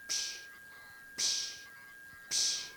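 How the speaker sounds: soft unvoiced psh for each stone; stones dropping. To the listeners, imitating stones dropped one by one, soft psh. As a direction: breathe out a quiet psh like a stone falling. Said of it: "you just hear stones drop".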